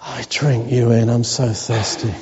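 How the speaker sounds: low, hushed model prayer. Shown as a demonstration. Pastor leading church in prayer, hushed and low. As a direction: show them how to pray it, low and tender. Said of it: "Just say".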